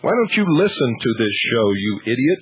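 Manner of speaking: normal talking voice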